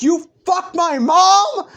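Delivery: slow, high, very loud yell; furious accusing shout. Acted. Bakugo charging through the portal, furious shout. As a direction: scream the accusation, stretching the words.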